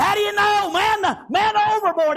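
high, shouted alarm; panicked crew. Acted. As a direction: yell the alarm over calm reassurance. Panicked crew answering calm John.

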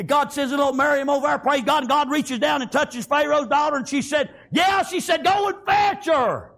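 impassioned revival storytelling; fervent preaching. Real, not acted. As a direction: build to a high, triumphant cry. Preaches at full force.

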